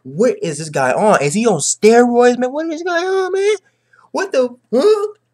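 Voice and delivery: high, panicked disbelief; freaking out. Retold or imitated. Caesar panicking as Luffy fights back, high squeal. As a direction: squeal in rising alarm. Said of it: "freaking out".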